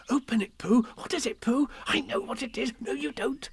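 high, eager little voices; high-pitched excited chatter; excited clamour. A narrator's reading. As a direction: squeal each phrase like a different eager onlooker. Everyone clamouring over the present.

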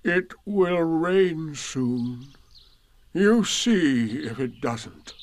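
slow gloomy donkey voice; Eeyore voice. Acted. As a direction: drag it out, gloomy and glum.

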